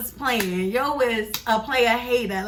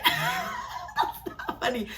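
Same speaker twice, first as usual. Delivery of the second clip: laughing; 5 dB quieter, much slower than usual. Real, not acted. Laughing through her own remark.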